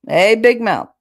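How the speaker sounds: loud mocking shout of a nickname; uncle calling out. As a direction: yell it across the room like a teasing uncle. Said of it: "He used to love to call me that".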